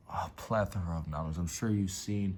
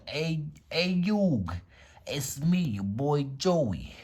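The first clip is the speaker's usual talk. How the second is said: put-on high, loud Brooklyn-accented Joey calling out to Yug; Joey impression; 7 semitones higher, 5 dB louder, much slower than usual. Vlogger imitates Joey calling to Yug, high Brooklyn accent. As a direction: play Joey Wheeler in a thick Brooklyn accent, hushing at the end. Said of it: "He sounds like Michael Rappaport".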